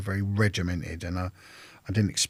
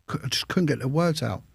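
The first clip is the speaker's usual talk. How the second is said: normal talking voice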